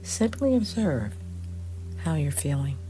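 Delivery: soft, unhurried meditation voice with long pauses; hushed, measured phrases that settle into silence; guided meditation. Acted. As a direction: guide listeners gently into stillness, leaving space between phrases.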